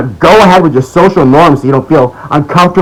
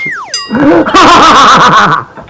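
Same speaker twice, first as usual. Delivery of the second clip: a burst of high, scornful laughter; 12 semitones higher than usual. Real, not acted. Vlogger laughing at critics, high scornful laugh. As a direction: laugh out at how absurd it is.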